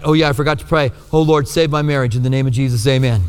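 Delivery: quick, perfunctory mock prayer; offhand bedtime prayer. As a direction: rattle it off as an afterthought before bed. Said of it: "you're going to bed at night".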